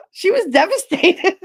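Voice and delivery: gasp, then laugh through "devastated". Real, not acted. Telling how the friend took it, gasping with laughter. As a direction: crack up mid-sentence.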